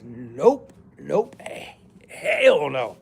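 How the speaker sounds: a put-on voice for nope, nope, hell no; mock refusal. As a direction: wave it off in a different voice. Acted refusal in other voice.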